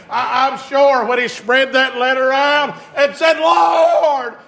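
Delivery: high, loud, slow climbing sermon shout; preaching at full force. Acted. Preacher shouting hope to the congregation, full force. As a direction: build to a roaring preacher's cry.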